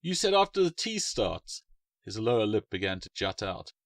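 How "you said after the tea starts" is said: put-on child's voice. Child asks about tea.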